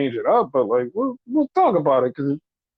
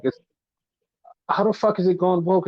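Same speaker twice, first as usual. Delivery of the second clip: ordinary delivery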